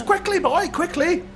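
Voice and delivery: high-pitched, impatient urging; urgent hurrying. Acted. Priest hurrying the boy with the mirror, urgently. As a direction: hurry the assistant along. Priest hurries his assistant.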